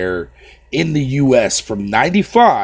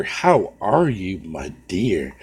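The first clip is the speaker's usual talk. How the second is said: slow drawn-out greeting; 3 semitones lower, much slower than usual. Real, not acted. Streamer repeats greeting to Snow Bunny, slow and drawn out. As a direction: draw it out lazily, fading on dear.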